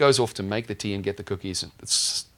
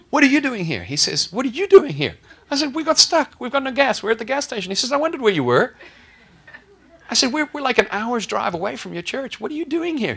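astonished exclamations; 9 dB louder than usual. Retold or imitated. Surprise meeting with the pastor.

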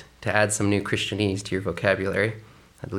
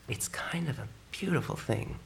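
whispered aside; soft whisper; 9 dB quieter than usual. Real, not acted. Pastor to congregation, soft whisper. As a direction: let it fall to a whisper. Continues hushed.

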